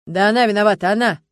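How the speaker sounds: loud, heated eyewitness; agitated. A narrator's reading. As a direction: accuse her insistently, all worked up. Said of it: "возбуждённо заговорил мужчина". (возбуждённо, громко)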